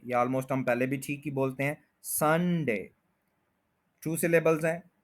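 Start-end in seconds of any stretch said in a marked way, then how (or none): 2.0-2.9 Sunday with a drawn, stressed SUN. Shown as a demonstration. Teacher modelling Sunday, Sunday stressed on सन. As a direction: lean on सन in Sunday, let डे fall. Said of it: "first के ऊपर stress है".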